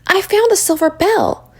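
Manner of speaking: high-pitched, eager announcement; breathless excitement. A narrator's reading. Rachel tells Esther about the bell, breathless and high. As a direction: call it out high, as if breathless with news. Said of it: "she called breathlessly".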